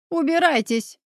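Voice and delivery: mild narrated squeal of outrage; understated shriek. A narrator's reading. Woman ordering the intruders out, in a restrained shriek. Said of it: "взвизгнула Елена".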